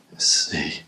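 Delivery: hushed whisper in the dark. Real, not acted. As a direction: whisper it under your breath. Filming in a dark tunnel.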